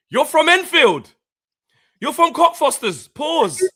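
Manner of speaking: high, loud, incredulous challenge; outraged retort. Retold or imitated. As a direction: shout it in mock outrage. Retelling his outraged retort.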